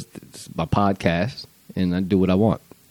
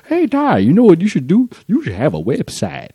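mocking old Facebook users; 8 semitones higher than usual. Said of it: "logging back into Facebook like it's a new world".

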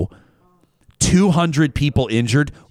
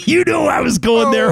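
gleeful shout; 13 semitones higher, 4 dB louder than usual. Real, not acted. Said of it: "that's good".

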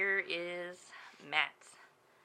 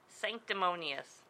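normal talking voice

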